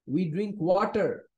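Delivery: slow, careful English model line; slow teaching read. Shown as a demonstration. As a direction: say it slowly for the class. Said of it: "let's read".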